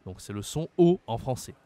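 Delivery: normal talking voice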